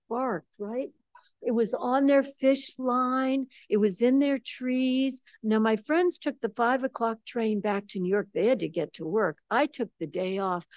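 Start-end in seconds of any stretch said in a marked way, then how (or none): none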